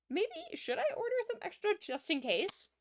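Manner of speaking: high-pitched, put-on musing voice; mock pondering manager. Streamer imagining a manager ordering stock, mock pondering. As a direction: play a manager stating the obvious as a question. Acting a manager's obvious thought.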